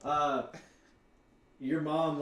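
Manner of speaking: usual speaking voice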